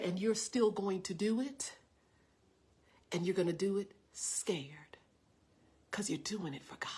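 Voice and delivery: low, near-whispered assurance; very calm. Retold or imitated. Big sister sending a scared preacher onward, calm and hushed. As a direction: insist gently in a steady hush. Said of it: "she was so calm".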